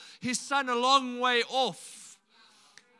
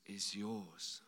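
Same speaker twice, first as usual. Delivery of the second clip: 1 tender father; 12 semitones lower, 12 dB quieter than usual. Retold or imitated. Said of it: "Verse thirty-one says".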